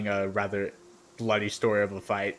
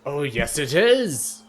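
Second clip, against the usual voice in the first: higher and louder, slow and insistent; the proud fighter defending his tale; boastful warrior insisting; 5 semitones higher, 8 dB louder than usual. Acted. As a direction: puff up and insist it was life or death. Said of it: "He's like".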